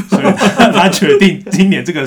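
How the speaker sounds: laughing through the words; high, loud laughing speech. Real, not acted. Laughing at a funny listener story.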